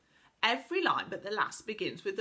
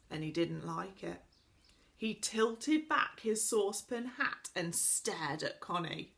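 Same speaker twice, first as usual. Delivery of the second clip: usual speaking voice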